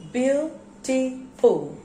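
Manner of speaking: BEAUTIFUL drawn out slowly in separate syllables. Shown as a demonstration. Teacher asking pupils to count syllables, spaced out. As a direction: break BEAUTIFUL into beau, ti, ful with pauses. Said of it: "How many syllables are there?".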